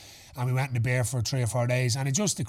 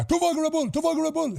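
high put-on voice. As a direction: bark the order in a high voice. [name] ordering in a put-on voice.

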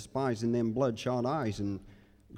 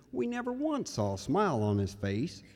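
ordinary talk

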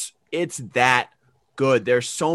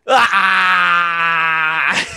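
loud high emotional "ah"; overwhelmed cry; 4 semitones higher, 6 dB louder than usual. Retold or imitated. Fan reacting to the movie credits, loud overwhelmed cry. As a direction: let out a loud overcome cry.

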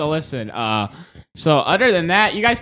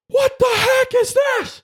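very high, mock-outraged shout; high-pitched scolding impression; imitated angry yell. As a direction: yell it high like someone telling you off. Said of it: "You wanna hear Chris yell at me saying".

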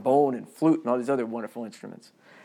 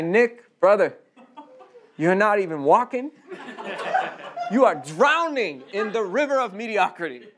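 louder, higher, mock-scolding call; exasperated rebuke; 6 semitones higher, 4 dB louder than usual. Teacher wishing to scold his stalled student: exasperated mock rebuke. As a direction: shake him awake with a frustrated plea. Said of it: "I don't wanna say".

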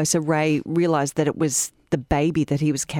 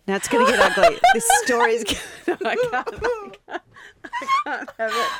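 laughing. Real, not acted. Trying to continue, laughing.

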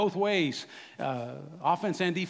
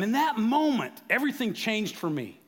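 ordinary talk

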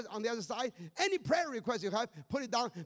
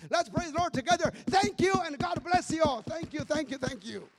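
loud praise; 6 semitones higher, 8 dB louder than usual. Real, not acted. Closing praise to congregation.

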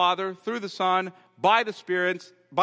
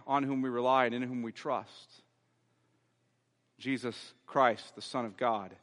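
closing prayer; 3 semitones lower than usual. Acted.

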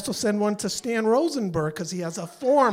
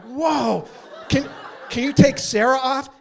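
high, eager astonishment; amazed; 7 semitones higher, 5 dB louder than usual. Retold or imitated. Caller finally through security, amazed and eager. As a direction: jump at the sudden chance.

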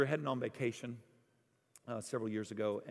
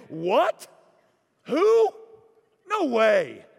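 high, loud startled disbelief; confused disciples; 11 semitones higher, 15 dB louder, much slower than usual. Retold or imitated. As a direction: blurt out stunned questions. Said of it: "Watch how confused and disoriented".

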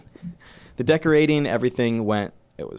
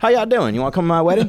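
put-on southern accent; 7 semitones higher, 5 dB louder, faster than usual. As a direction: play a cheesy country host. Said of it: "Your southern accent is so bad".

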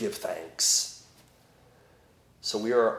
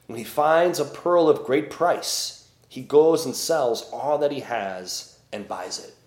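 solemn, measured scripture reading; gospel reading; 3 semitones higher, 5 dB louder than usual. Acted. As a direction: proclaim it like a lector at Mass.

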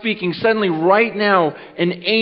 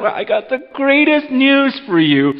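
higher-pitched, eager announcement; acting out a friend bubbling with news; grinning excitement; 6 semitones higher than usual. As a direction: burst in with great news through a grin. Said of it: "got that big grin on their face".

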